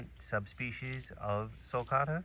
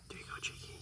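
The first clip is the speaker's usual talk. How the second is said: whisper; 13 dB quieter, faster than usual. Real, not acted. Coaxing a prairie dog.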